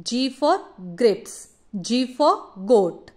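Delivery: very slow, spelling-out "G for grapes"; hard g, slowly. Shown as a demonstration. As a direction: sound out each word slowly for a child. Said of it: "सिर्फ ग करवाते हैं".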